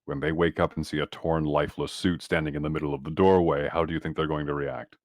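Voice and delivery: ordinary delivery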